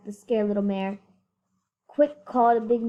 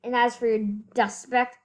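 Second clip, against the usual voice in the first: plain reading voice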